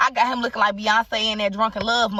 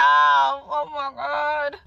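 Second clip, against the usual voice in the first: very slow, higher, drawn-out exclamation; excited exclamation; 6 semitones higher, much slower than usual. Real, not acted. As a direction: stretch it out, overwhelmed. Overwhelmed exclamation.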